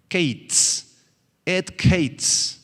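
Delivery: "et kates" said slowly and carefully, each syllable clear; et kates, spoken as Hebrew; Hebrew term. Shown as a demonstration. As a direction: pronounce "kates" like "keits". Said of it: "la palabra hebrea para tiempo del fin".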